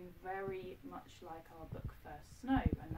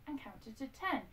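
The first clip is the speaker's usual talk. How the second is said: ordinary delivery, nothing marked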